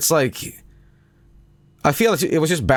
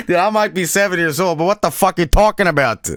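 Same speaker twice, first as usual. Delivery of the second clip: louder exasperated; 9 semitones higher, 5 dB louder than usual. Real, not acted. Voicing his retort.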